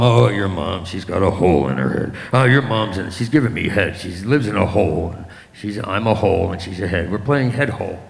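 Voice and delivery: put-on low, rambling old-dad voice; older dad. Older dad rambling to son about Mom, low confused drone. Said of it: "now he's older".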